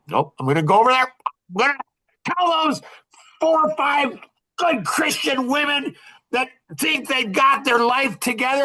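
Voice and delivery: a husband telling his wife he will confront them, rising angry shout; retold furious retort. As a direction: rattle off the refusal, then rise into an angry shout. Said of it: "Man, was I hot".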